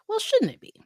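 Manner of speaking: high joking protest; a playful high retort; mock objection. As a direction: pop up high like a cheeky kid.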